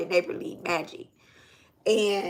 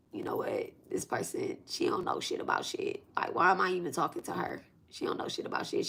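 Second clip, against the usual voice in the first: whispered, much softer, half under her breath; hushed inner thought; 2 semitones lower, 9 dB quieter than usual. Retold or imitated. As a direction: mutter it to yourself like a private thought. Retold inner thought, voice dropped.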